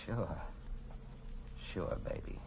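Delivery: low, soft, near-whispered reply; hushed. Acted. Detective to the woman he'll turn in, hushed. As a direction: breathe it close to her ear. Lovers' kiss before betrayal.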